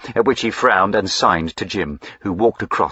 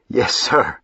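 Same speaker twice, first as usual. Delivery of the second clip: higher, bashful yes; sheepish admission; 5 semitones higher than usual. A narrator's reading. Servant admitting his sweetheart, higher and sheepish. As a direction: admit it, caught out and embarrassed. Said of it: "Salter blushed and stammered hopelessly".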